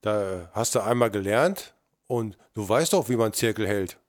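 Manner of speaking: usual speaking voice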